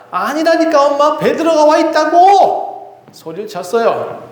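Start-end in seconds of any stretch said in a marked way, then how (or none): 0.1-2.7 put-on high, slow insistent child's cry; a little girl yelling so they'll finally listen; child shouting. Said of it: "아이는 소리칩니다". (아무도 안 믿자 엄마에게 소리치는 아이)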